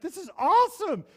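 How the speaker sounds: exultant, near-shouted celebration; thrilled. Retold or imitated. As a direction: cheer it out like a high-five. Celebrating crowd of 129.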